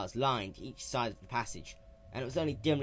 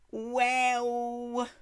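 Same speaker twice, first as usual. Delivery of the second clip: raised, brash tomboy pony voice; lightly raised young heroine; Rainbow Dash voice; 13 semitones higher, 5 dB louder than usual. Acted. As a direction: play her sporty and cocky.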